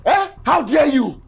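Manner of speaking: angry shouting; furious shout. Acted. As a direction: berate her, shouting. Husband shouting at his wife.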